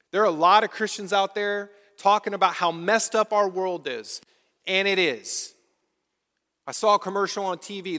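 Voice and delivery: rising pulpit fervor; full-force preaching. Acted. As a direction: preach it out to the whole church.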